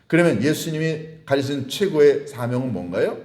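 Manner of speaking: ordinary delivery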